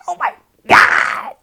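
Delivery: loud, high drawn-out exclamation; overwhelmed cry. Retold or imitated. Reliving brother's tribute on stage, overwhelmed cry. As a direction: belt it out in overwhelmed disbelief.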